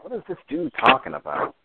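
high, baffled disbelief; incredulous. Real, not acted. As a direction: ask it in total disbelief. Baffled by troll's insults.

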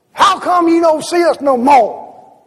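put-on an old man calling her out, loud and high; old man's complaint. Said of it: "he s-, says".

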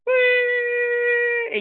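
long, high, steady siren wail; imitated siren. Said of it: "they're like".

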